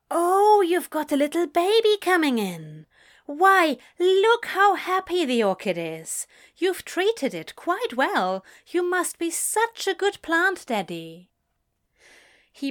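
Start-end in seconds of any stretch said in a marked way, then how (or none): 0.1-11.3 much higher and louder, cooing; gushing delight. Acted. Shopkeeper Jan coos delightedly over Crowley's orchid baby. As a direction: gush over the little baby plant.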